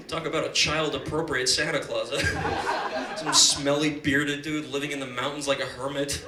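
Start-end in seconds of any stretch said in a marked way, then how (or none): none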